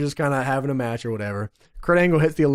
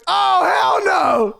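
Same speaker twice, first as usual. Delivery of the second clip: high, slow, drawn-out mock outrage; Sharmell's outrage; 3 dB louder, much slower than usual. Playing Sharmell at Kurt Angle, slow shouted outrage. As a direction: stretch it out like an indignant wife. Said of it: "Sharmell goes".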